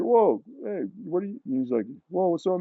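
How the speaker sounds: high-pitched imitation of a surprised friend; retold startled greeting. Friend greeting an unexpected visitor in the house, retold surprise.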